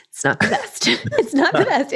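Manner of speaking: high-pitched, laughing concession; laughing understatement. Real, not acted. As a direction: giggle through the words. Laughing at her own understatement.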